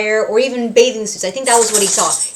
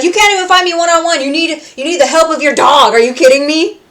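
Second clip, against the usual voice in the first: loud, higher, fast exasperated taunt; indignant outburst; 7 semitones higher, 7 dB louder, faster than usual. Retold or imitated. Alistair scorning a fighter who needs his pet, loud and fast. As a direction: spit out the insult at speed. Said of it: "He's like".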